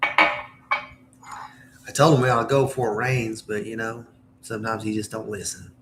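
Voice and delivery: Southern Indiana country twang; Southern accent. Shown as a demonstration. Demonstrating her hometown Southern accent.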